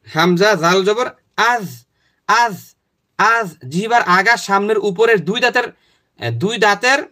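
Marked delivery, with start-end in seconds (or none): 0.1-3.5 slow spelling out. Shown as a demonstration. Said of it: "তারপরে".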